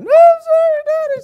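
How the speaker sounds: very high, loud childlike mock wail; put-on high-pitched cry of a small child; crying little daughter. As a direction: imitate a little girl's squeaky tearful plea. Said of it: "she does a little cry thing".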